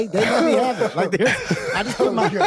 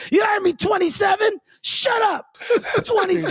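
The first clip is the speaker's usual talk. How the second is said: high, pushy taunt; high-pitched heckler holler; mock heckling; 11 semitones higher than usual. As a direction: jeer at the player up high. Imitating hecklers calling players by number.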